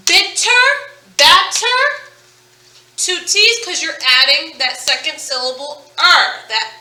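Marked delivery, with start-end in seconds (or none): 0.1-1.8 "bitter", "batter" said high and crisp with a clear t; sounding out words. Shown as a demonstration. Teacher demonstrating double T words to class, crisp t.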